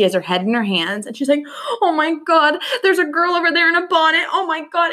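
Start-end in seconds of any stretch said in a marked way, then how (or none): 1.8-4.9 hammed-up panic, higher and louder; fake scared crying. Sister fakes seeing a ghost girl, mock terrified. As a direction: overplay the fright like a kid faking it. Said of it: "fake crying".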